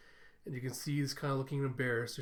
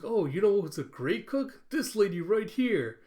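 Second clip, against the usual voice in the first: loud, high, acted boasting on another's behalf; enthusiastic recommending; 7 semitones higher, 7 dB louder than usual. Coworker points out Camilla as great cook, acted enthusiasm. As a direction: show someone off, slowing on the final words.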